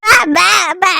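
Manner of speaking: muffled sounds through a shut mouth; gagged mumbling. Acted. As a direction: try to speak with lips taped.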